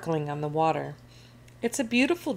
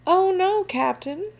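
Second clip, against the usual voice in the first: high, loud warning cry; alarmed shout; 13 semitones higher, 5 dB louder than usual. A narrator's reading. First mate warning captain of a storm, shouting. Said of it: "shouts First Mate Callie".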